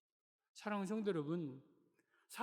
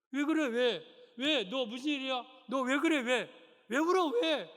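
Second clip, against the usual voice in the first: loud, higher urgent questioning; alarmed father; 7 semitones higher, 8 dB louder than usual. Acted. Father bursts into crying son's room, loud alarmed questions. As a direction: demand what's wrong, worried.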